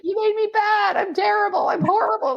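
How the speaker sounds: high-pitched dramatic wail; anguished self-condemnation. Retold or imitated. Retelling her answer to God about who made her, wailing.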